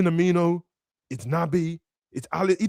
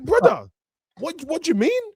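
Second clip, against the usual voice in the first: baffled high-pitched reply; 13 semitones higher than usual. Retold or imitated. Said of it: "I'm like".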